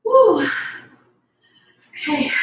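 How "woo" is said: high, loud "woo" of exertion; relieved whoop. Real, not acted. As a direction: whoop as the hard set ends. Finishing a hard set.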